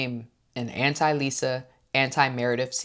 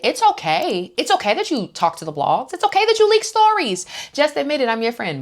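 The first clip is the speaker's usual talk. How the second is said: high, louder sing-song coaxing building to a squeal; hammed-up Heather; 10 semitones higher, 4 dB louder than usual. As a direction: pile on the fake reassurance.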